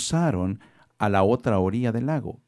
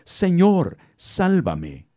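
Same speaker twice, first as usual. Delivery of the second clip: loud, high cry for help; grito desesperado; 10 semitones higher, 6 dB louder, much slower than usual. A narrator's reading. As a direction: shout while sinking, desperate. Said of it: "empezó a hundirse y gritó". (grito de auxilio fuerte y agudo)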